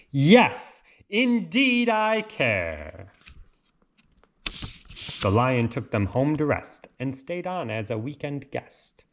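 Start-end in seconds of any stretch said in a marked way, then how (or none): 0.1-3.1 shouted. A narrator's reading. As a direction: burst out, then stretch it. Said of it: "who shouted".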